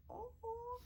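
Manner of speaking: very high, thin "oh"; high-pitched coo of happy disbelief; delighted squeal. Retold or imitated. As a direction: re-enact a joyful high squeal. Acting out reaction to being accepted.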